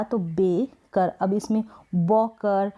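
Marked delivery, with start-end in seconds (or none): 0.2-1.1 बे कर slowly, with a long "ay" in बे; letter-name vowel. Shown as a demonstration. Teacher models the right reading, बे कर in slow syllables. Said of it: "ए को ए हमने यहाँ पर पढ़ा". 2.0-2.7 बॉकर with an open "aw" in the first syllable; wrong vowel demo. Shown as a demonstration. Teacher shows learners what to avoid, बॉकर with "aw". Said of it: "इस तरीके से नहीं पढ़ेंगे".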